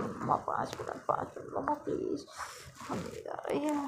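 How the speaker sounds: half-asleep, whispered pleading to mom; sleepy voiceless murmur from under the covers. Acted. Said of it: "मतलब को बहुत ही नींद आ रही होती है".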